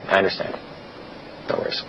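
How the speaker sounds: calm, unbothered and dropping to a near whisper; flat acceptance, voice fading out; matter-of-fact. Shown as a demonstration. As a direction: say it like it costs you nothing. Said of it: "Very matter-of-factly".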